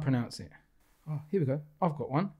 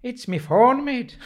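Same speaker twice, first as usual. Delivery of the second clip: higher, hammed-up Irish accent; playful stage Irish, pitched up; 4 semitones higher, 4 dB louder than usual. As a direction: show off your comic Irish accent. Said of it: "I can do a good Irish accent".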